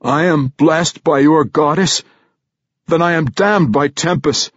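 a warrior reeling in confusion; agitated barbarian. A narrator's reading.

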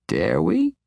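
high, rising conspiratorial question; mischievous invitation. Acted. Old wizard holds up gem and staff, mischievously daring. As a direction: pitch it up as a playful dare.

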